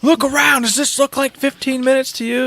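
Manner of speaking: put-on high, indignant boy; Atreus voice. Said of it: "And he".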